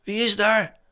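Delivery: hoge, licht bange tegenroep; trillende roep terug. A narrator's reading. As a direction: roep als een andere stem angstig terug. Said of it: "riep een andere trillende stem terug". (met andere trillende stem terugroepen)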